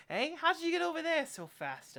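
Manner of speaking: very high, loud character voice; startled question. Acted. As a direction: blurt it high in alarmed surprise. Seamstress startled by the visitor.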